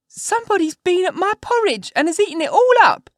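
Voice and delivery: put-on very high, loud squeaky baby-bear voice; little wee bear. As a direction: squeal it out as a tiny, outraged bear. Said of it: "in his little wee voice".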